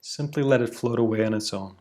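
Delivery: usual speaking voice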